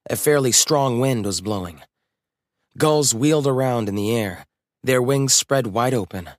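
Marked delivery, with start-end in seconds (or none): none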